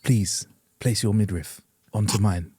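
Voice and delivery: low, slow mock-romantic voice; hammed-up Bridgerton suitor, low and slow; mock seductive line. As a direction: put on a deep, slow seducer voice. Mock romantic line, role play.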